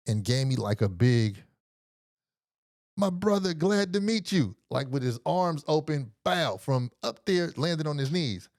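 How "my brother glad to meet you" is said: booming greeting. Retold or imitated. The doctor welcomes the visitor down the stairs, high and grand. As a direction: greet him in a much higher, big welcoming voice.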